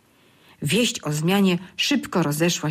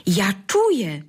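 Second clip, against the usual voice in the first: happy exclamation; 2 semitones higher, slower than usual. A narrator's reading. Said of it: "zawołał".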